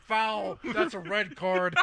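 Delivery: high, loud ref call; possessed referee. Retold or imitated. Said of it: "full battle armor goes".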